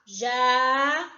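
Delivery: JÁ held long and loud on its own; separate syllable. Shown as a demonstration. Teacher counting Japão's syllables with pupils, drawn out. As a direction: stretch JÁ out as one syllable. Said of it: "pronuncie a palavra Japão e conte no dedo".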